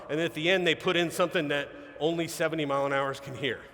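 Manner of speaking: ordinary talk, nothing marked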